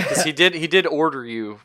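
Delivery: usual speaking voice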